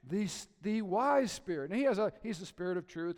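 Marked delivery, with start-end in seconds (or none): 0.6-1.7 "wise" raised and louder, slowly; stress on "wise". Shown as a demonstration. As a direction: pick out "wise" as the name. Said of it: "think about what his name could have been".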